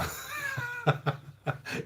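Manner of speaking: real laugh that starts high and tumbles down; high cackling laugh. Real, not acted. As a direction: burst into a high, falling cackle. Laughs at fucking ham joke.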